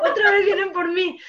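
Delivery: high mock-panicked cry; retold panic. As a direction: act out dread of pursuers, hammed-up. Retold fear of being followed again.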